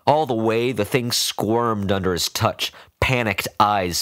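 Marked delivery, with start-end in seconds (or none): none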